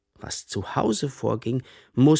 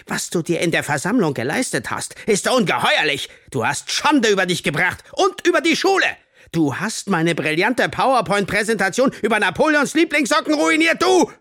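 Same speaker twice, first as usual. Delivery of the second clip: loud, high, escalating fury; rant, building to a shouted climax; outraged tirade; 10 semitones higher, 5 dB louder than usual. A narrator's reading. Teacher berates pupil over ruined presentation, outraged shouting.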